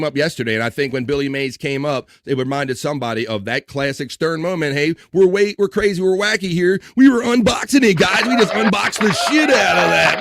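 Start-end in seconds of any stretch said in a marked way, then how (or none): 4.7-10.2 mocking hype host. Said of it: "mocking himself as hype host".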